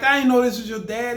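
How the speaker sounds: high, loud surprised exclaim; excited surprise. Retold or imitated. Telling the boy he didn't know, in high surprise. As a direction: sound amazed to see him. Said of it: "I'm like".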